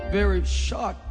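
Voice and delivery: usual speaking voice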